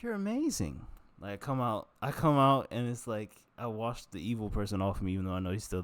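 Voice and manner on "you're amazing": high, sing-song compliment to himself; high self-praise. Retold or imitated. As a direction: gush at yourself after the shower. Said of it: "I'm like".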